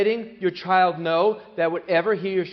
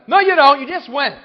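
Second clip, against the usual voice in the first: much higher, much louder snap of refusal; dismissive parent; 8 semitones higher, 11 dB louder than usual. Retold or imitated. Parent to child asking for bathroom: loud high refusal. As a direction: shut the kid down, loud and high.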